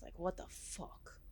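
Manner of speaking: breathy whisper under the breath; whispered aside. Real, not acted. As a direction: drop to a whisper for the curse. Muttered aside at the table.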